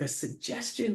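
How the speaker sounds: high, hushed disbelieving echo; acted quiet incredulity; incredulous echo. Echoes rival's word in disbelief.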